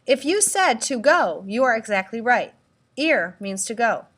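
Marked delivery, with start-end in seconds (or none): none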